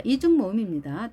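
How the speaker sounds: ordinary delivery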